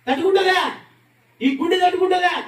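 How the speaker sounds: loud pleading repeat; anguished cry. Shown as a demonstration. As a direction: cry it out from the heart. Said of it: "బాగా వినాలి మీరు".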